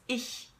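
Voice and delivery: ich ending in a soft hissed ch; German ich. Shown as a demonstration. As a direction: hiss the ch in ich.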